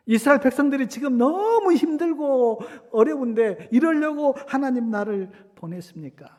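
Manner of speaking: loud, high acted complaint to God; Moses' anguished reproach. Moses protesting to God for his suffering people, reproachfully. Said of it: "모세가 하나님께 원망해요".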